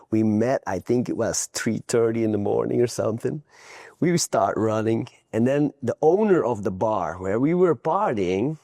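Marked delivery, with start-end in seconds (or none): none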